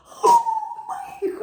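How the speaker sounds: high shrieked oh my God; retold scream. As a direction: shriek it out in shock. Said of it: "she screamed".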